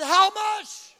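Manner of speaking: very high, loud mock screech; shocked outburst. Appalled husband repeating wife's question, high shocked shout. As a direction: shout it back in hammed-up horror. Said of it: "I was shocked".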